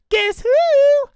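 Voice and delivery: sweet high voice. Acted. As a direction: fake a sweet mum calling. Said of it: "in his now sweet and high voice".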